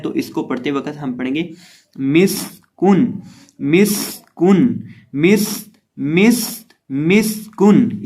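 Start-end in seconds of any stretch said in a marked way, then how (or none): 2.0-8.0 मिस्कुन slow, स held as a sharp whistle; whistled seen. Shown as a demonstration. Teacher drilling the tajweed rule, long whistled स in मिस्कुन. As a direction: stretch the whistling स in मिस्कुन before landing on कुन. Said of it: "की आवाज़ पैदा करते हैं".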